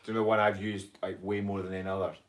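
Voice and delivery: ordinary delivery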